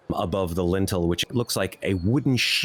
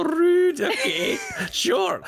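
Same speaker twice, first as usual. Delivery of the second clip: high, laughing mock indignation. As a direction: laugh it off, pretend offended. [name] mock-offended, laughing.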